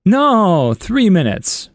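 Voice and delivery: put-on cheeky kid voice. Son negotiating shorter bath time, high cheeky. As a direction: pitch it up like a bargaining child.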